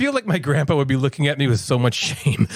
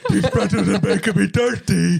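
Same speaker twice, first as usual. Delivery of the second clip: mock man voice; 4 semitones higher than usual. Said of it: "That was supposed to be Natalie doing the man voice".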